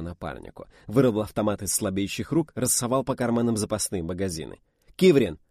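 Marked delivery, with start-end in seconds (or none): 4.9-5.4 loud, raised shout of a name; calling out. A narrator's reading. Narrator summons Kivrin in battle, sharp shout. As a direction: shout to a comrade in a fight.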